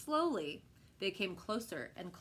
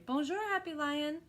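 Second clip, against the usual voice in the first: put-on child's greeting; 8 semitones higher, 3 dB louder than usual. A narrator's reading. Child greets lion in story.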